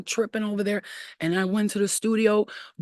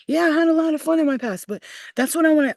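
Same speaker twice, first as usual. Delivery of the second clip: excited; 9 semitones higher, 5 dB louder, faster than usual. Real, not acted. Excited reminiscing.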